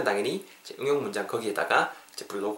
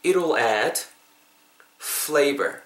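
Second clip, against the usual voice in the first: "It'll add", a long pause, then "flavor"; broken into parts; much slower than usual. Shown as a demonstration. Teacher asking learners to repeat, phrase in two chunks. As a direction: split it before "flavor" for repeating. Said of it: "이거 한번 따라해 보세요".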